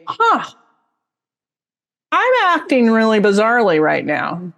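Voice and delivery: high "huh", then mildly bemused realisation; puzzled self-noticing. Acted. Teacher voicing stepping back to observe herself, mildly bemused. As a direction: step back and notice yourself, lightly acted.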